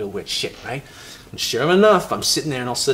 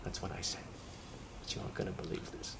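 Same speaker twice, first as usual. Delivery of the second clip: whispered, much softer; hushed aside; 4 semitones lower, 19 dB quieter than usual. Acted. Confiding the twist to his friend, whispered. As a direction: lean in and whisper it.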